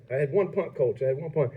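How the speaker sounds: put-on Australian accent. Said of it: "Did you try to do an Australian accent there?".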